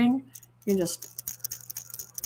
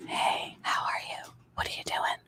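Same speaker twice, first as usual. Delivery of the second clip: soft, voiceless whispered greeting; ASMR whisper; 9 dB quieter than usual. Retold or imitated. Catching herself talking to dog or husband, whispering. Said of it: "Why are you whispering?".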